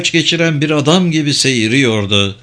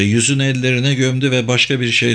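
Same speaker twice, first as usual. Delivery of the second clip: ordinary delivery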